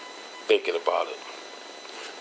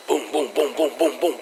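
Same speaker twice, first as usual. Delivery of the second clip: higher pitched booming footstep sounds; mimicked footsteps; 5 semitones higher than usual. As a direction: thump it out like steps chasing you. Imitating loud footsteps.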